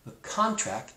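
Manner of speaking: ordinary talk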